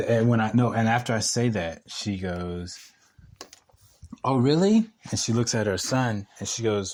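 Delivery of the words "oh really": mock-intrigued, higher and louder; higher, louder questioning voice imitating an intrigued mom; intrigued challenge. Landlord hearing tenant boxes, mock intrigued. Landlord reacts to his boxing claim.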